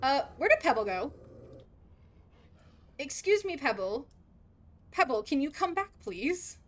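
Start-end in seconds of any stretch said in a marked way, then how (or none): none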